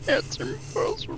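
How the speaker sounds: whispery high echo of a scream; tiny, voiceless pretend wail; mock strained cry. Co-host echoing the Luke cry, whispered mock yell. Echoes the movie cry softly.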